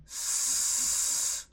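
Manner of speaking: quiet unvoiced hiss, just air; soft hiss. Shown as a demonstration. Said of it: "y digan". (un siseo suave, solo aire)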